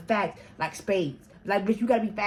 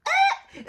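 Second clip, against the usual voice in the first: short high-pitched cry; sudden yelp; 5 dB louder than usual. Retold or imitated. Sudden outcry mid-story.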